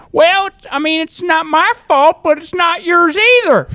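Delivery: very high put-on old man; grandpa voice. Grandpa answering the restaurant manager. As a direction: talk like a helpless grandpa. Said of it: "I'd be like".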